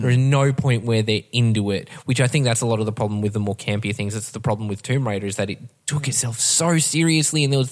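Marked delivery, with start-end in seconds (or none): none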